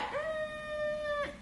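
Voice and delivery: long, high doubtful "mm"; drawn-out unconvinced "mmm"; skeptical hum. Retold or imitated. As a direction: hum it slowly, side-eyeing the screen. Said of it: "I was like".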